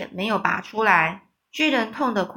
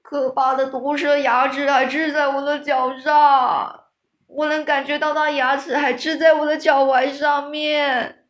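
loud, higher frantic wail; panicked yelling; 5 semitones higher, 6 dB louder than usual. A narrator's reading. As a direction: yell in terror about the fangs. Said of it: "于是他又大叫着".

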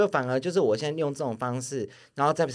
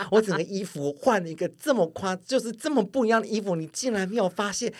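imitating angry boyfriend; 7 semitones higher than usual. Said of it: "然后整个跟我很生气".

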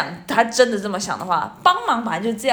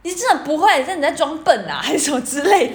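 higher, louder accusing tone; retold scolding; 6 semitones higher, 3 dB louder than usual. Sister scolding the pupil for faking stupidity, higher and louder. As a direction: ask if he's playing dumb, fed up. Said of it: "然后过不久听到说".

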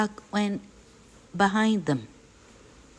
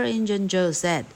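ordinary delivery, nothing marked

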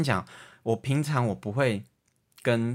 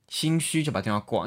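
normal talking voice

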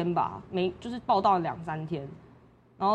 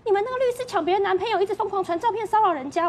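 put-on high, fast, accusing girlfriend voice; high-pitched mock accusation, rushed; mock angry girlfriend; 13 semitones higher, 3 dB louder than usual. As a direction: play the shrill accuser jabbing fast. Retelling girlfriend's angry call.

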